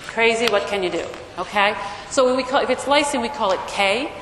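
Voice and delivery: ordinary talk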